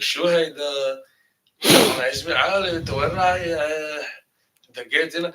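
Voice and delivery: loud Levantine dialect demo; Lebanese-Jordanian Arabic. Shown as a demonstration. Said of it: "if you speak, uh, Jordanian or, uh, Lebanese".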